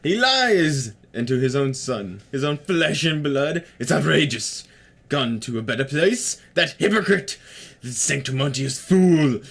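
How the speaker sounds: put-on voice, theatrically outraged, drawing words out; throat-straining character voice. Player hamming up a wronged character's rant, theatrically indignant. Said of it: "doing that fucking voice hurts".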